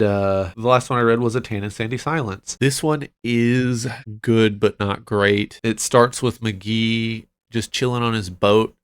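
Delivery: ordinary talk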